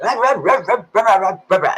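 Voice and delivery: mock Nigerian yelling. Imitating her Nigerian boyfriend barking at her. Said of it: "they be yelling a lot".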